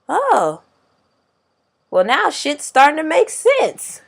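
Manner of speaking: high, loud mock revelation; imitating coworker's realization. Coworker finally understanding the aggression, imitated in loud realization.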